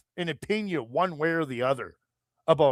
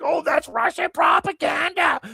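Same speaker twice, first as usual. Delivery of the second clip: much higher, shrill-free mock shout of a dismissive woman; hammed-up high mock voice of the banning host; mocking impression; 11 semitones higher than usual. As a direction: squeal it like a scornful channel host brushing him off. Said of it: "She's like".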